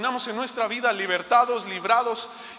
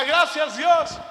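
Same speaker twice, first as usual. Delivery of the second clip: gritando gracias a Dios, más alto y agudo; alabanza emocionada; 7 semitones higher, 5 dB louder, much slower than usual. Retold or imitated. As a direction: revive el salto de alegría en voz alta. (gritando de alegría agradecida)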